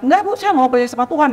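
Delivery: quick, high-pitched mock protest; imitated defiant child. Student talking back to a teacher, mock defiant. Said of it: "kalau anak yang ngelawan".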